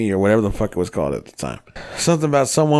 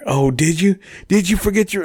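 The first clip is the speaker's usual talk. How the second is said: mock accusing girlfriend; 9 semitones higher than usual. Girlfriend voiced in retold fight.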